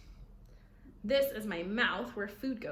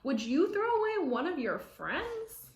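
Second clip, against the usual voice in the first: horrified grandma; 8 semitones higher, 6 dB louder than usual. Acted. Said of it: "Grandma looked horrified".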